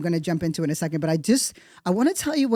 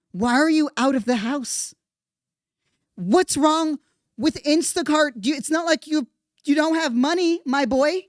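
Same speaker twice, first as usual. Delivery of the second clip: exasperated scolding; 8 semitones higher than usual. Pretend. Scolding Mangione directly, exasperated.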